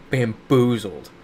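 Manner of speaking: ordinary talk, nothing marked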